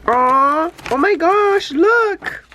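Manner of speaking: high, breathless gushing; giddy delight; high excited squeal. Real, not acted. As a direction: squeal it as you spot it. Excited unboxing.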